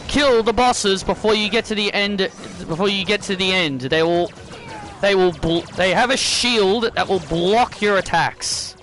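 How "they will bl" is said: usual speaking voice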